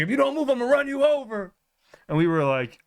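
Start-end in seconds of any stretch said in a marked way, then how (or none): 0.0-1.5 high, quick mock threat. Driver threatening to run the scammer over, fast high mock threat. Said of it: "he's like".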